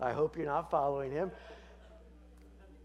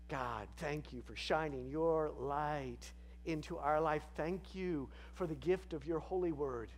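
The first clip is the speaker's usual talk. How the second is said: prayer; 3 dB quieter than usual. Acted. Leads a prayer.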